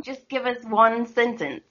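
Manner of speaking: attempted posh English accent; American trying out a posh English voice. Shown as a demonstration. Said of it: "practice doing an English accent".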